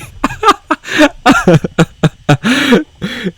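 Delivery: shrieking high-pitched laugh; high squealing laugh. Real, not acted. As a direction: burst into a squeaky cackle. Reaction to own joke.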